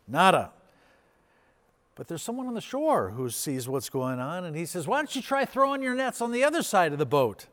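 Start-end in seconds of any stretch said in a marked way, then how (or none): 0.1-0.5 emphatic punch. Acted. Punchline after "Nothing. Nix. 4.9-7.5 calling out. Acted. Stranger calls from the shore.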